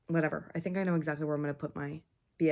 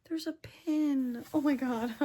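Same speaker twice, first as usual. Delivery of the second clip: surprised squeal; 8 semitones higher, slower than usual. Real, not acted.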